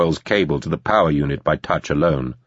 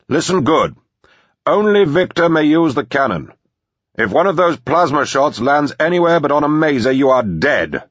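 high, raised threatening call; shouted warning; 8 semitones higher, 3 dB louder than usual. A narrator's reading. Manor voice warning about the cannon, shouting. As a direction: lay down the rule from afar.

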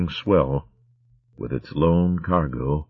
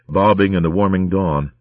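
usual speaking voice

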